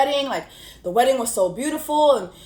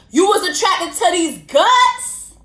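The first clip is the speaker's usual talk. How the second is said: loud, slow, sassy jab; mock indignation; 6 semitones higher, 6 dB louder than usual. Real, not acted. Vlogger mocking Chris on Paige's behalf, sassy and loud. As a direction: throw it at him sarcastically. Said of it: "mocking Chris".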